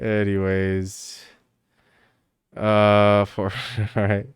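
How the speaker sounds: normal talking voice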